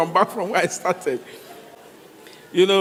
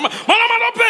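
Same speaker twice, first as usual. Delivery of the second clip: high, loud protesting cry; high pleading shout; 4 dB louder than usual. Retold or imitated. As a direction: squeal it out in protest. Protesting to the buka madam.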